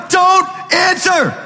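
high, slow shout of don't answer; shouted warning. Real, not acted. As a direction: hammer the warning out slowly and high. Preacher warning against calls.

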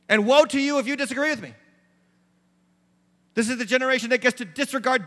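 loud, rising preacher's exhortation; full-force preaching. Acted. Pastor pleading with the congregation, loud and rising. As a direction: drive it home like a pulpit call.

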